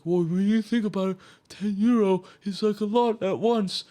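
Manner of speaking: mocking a stingy friend. Mocking a friend who overvalues ten euro, whiny mock voice. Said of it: "the guy who's like".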